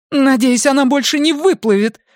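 high-pitched spiteful woman; bitter anger. A narrator's reading. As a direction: say it high and venomously. Angry woman wishes the other gone.

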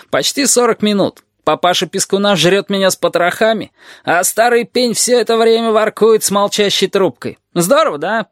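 high-pitched sarcastic griping; aggrieved lizard. A narrator's reading. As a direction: complain hotly, capped with a bitter tag question. (ящер, возмущённо жалуется)

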